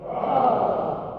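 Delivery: ordinary talk, nothing marked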